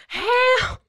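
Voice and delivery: very high, comic yelp; hammed-up high call to passing cars; mock cry for help. Escaped captive flagging cars down, high mock cry for help.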